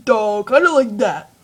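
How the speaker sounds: lightly done dopey weasel voice; a hint of a dim cartoon henchman; Stupid. Shown as a demonstration. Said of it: "he sounds stupid".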